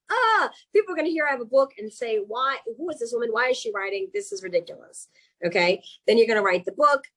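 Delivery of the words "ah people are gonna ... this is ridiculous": high-pitched, climbing incredulous critic; mock scornful disbelief. Author imagines readers judging her book, mocking high-pitched scorn. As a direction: imitate doubters, pitch jumping up.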